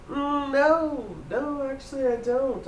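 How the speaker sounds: high, sweet feigned innocence; innocent denial. Acted. Gloria denying to the constable in a high, innocent voice. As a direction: play dumb, sweetly and high.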